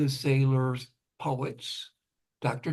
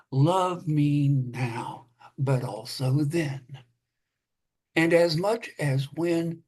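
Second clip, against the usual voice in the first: slow measured poetry reading; poem reading. Acted. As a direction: read it like a poet, unhurried. Poet reads own poem.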